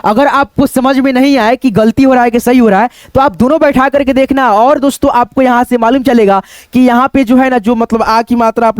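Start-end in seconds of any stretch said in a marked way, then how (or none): none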